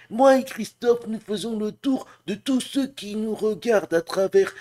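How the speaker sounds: very high, drawn-out cartoon bear voice; high cartoon character voice with stretched phrase endings; Winnie l'ourson. Shown as a demonstration. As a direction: put on a high-pitched, friendly storybook bear. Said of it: "C'est moi qui fais la voix de Winnie l'ourson".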